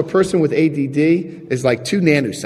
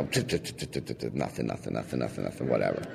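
much softer and lower, fading away; trailing mumble; 15 dB quieter than usual. Real, not acted. Shrugging off his minor sins, fading mumble. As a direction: toss off the list, fading to a mumble. Listing trivial confessions.

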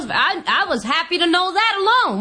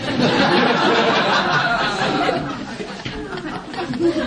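long laugh; 5 semitones lower than usual. Real, not acted. Laughing at own story.

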